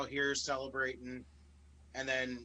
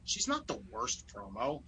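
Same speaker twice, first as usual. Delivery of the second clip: ordinary talk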